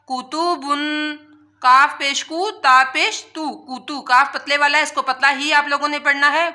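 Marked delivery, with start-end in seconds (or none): none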